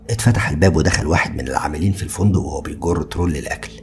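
ordinary delivery, nothing marked

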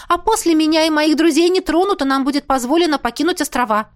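eager bargaining; quickly added. A narrator's reading. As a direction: press the next condition hastily. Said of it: "быстро добавила".

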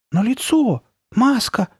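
put-on high, scared little-boy voice; high-pitched lingering alarm; faltering fright. A narrator's reading. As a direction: get it out in short frightened bursts. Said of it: "всё ещё запинаясь, пробормотал Ноа".

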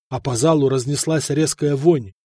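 ordinary delivery, nothing marked

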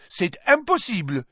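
high, loud French protest; high indignant French madame; Madame Maxime's outburst. A narrator's reading. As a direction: cry it out in haughty French.

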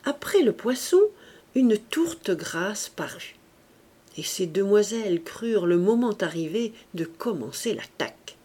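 usual speaking voice

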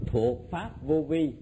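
slow, spaced dictation to class; dictating slowly. Shown as a demonstration. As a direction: spell it out word by word, slowly.

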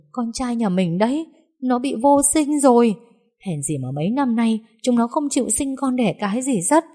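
high-pitched lamenting; tearful wailing. A narrator's reading. Mother wailing to her husband that their son is infertile. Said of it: "mếu máo khóc to".

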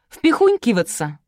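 high, slow, loud pronouncement; haughty. A narrator's reading. Girl correcting everyone, haughty. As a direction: announce the word with airs. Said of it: "Девица вздёрнула подбородок".

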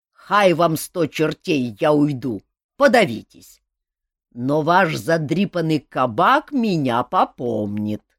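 loud, slow furious threats; furious Cossack. A narrator's reading. Angry Cossack threatens the tavern crowd, loud and slow. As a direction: spit each threat out as you leave.

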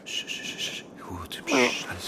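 soft shushing, barely voiced; doctor quieting the struggling patient; hushing whisper. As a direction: hush him down gently.

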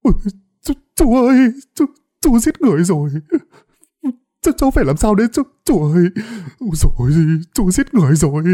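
high, loud crying wail. A narrator's reading. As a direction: sob out to the uncle in panic. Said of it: "miệng khóc lớn". (thằng Thạch khóc lớn, giọng cao hoảng loạn)

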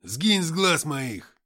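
loud, slow furious bark. Acted. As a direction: bark it out in rage. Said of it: "рявкнул Антоха".

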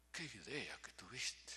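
soft, slow whisper; hushed and moved; tearful whisper. Retold or imitated. Cousin with tears in his eyes, soft whisper.

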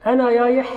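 يايح repeated, y for r; lisp r as y. Shown as a demonstration. Teacher repeats the lisp: r as y. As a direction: demo يايح with y in place of r. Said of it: "قلبت الراء ياء".

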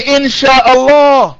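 "Insha'Allah" drawn out slowly, high-pitched; emphatic Insha'Allah. Shown as a demonstration. Insists on the required phrase.

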